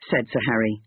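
plain reading voice

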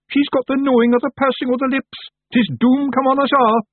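very high, loud Feagle alarm; panicked doom-crying. Acted. A Feagle warns his brothers of doom, in panic. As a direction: cry out that all is lost.

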